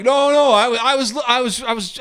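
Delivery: high, stammering denial; mock flustered excuse. Acting out flustered excuse when caught.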